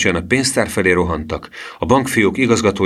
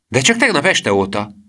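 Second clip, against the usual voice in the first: put-on higher-pitched boyish objection; mild, pitched-up outcry; interjected cry; 7 semitones higher than usual. A narrator's reading. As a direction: call out to contradict him. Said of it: "kiáltotta Gusztáv".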